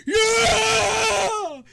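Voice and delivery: one long, high, full-force scream; crowd screaming. Acted. Crowd going crazy for the champion, screaming. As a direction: scream it like a whole crowd erupting. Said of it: "everybody just starts screaming".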